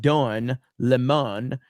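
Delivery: Don Lemon as a slow "Dun le-MON"; deliberate mispronunciation. Shown as a demonstration. Host repeating a viewer's spelling, Don Lemon slowly as "Dun le-MON". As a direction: draw out Don Lemon, weight on the last syllable. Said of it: "So you said Don Lemon".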